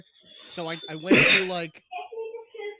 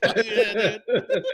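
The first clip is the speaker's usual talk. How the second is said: laughing mock cheer; 3 semitones higher, 10 dB louder, much slower than usual. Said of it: "I'm like".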